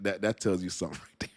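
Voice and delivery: ordinary talk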